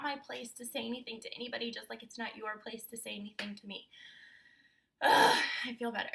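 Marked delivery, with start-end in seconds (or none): 5.0-6.1 loud relieved groan then words; a loud sigh of relief; relieved ugh. Real, not acted. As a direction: let it all out with a big ugh. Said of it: "I just had to get that out".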